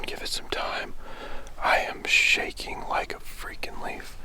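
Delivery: breathless whisper right after the shot; shaky, awed whisper; hushed whisper. Real, not acted. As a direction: whisper so the woods stay quiet.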